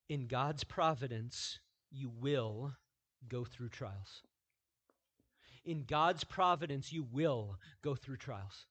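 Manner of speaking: ordinary delivery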